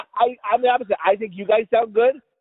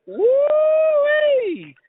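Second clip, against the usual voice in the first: long high-pitched gleeful whoop. Real, not acted. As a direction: let out a soaring woo-wee. Whoops about food and a joint.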